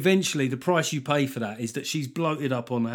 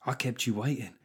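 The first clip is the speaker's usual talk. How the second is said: snide inner voice; 7 dB quieter than usual. Retold or imitated. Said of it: "They're like".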